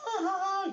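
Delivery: high-pitched imitated group laughing; mock laughter. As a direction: imitate soldiers laughing in delight. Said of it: "they all start laughing".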